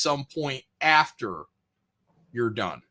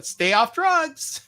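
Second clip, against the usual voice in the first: mock quote; 12 semitones higher, much slower than usual. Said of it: "after Nina said that".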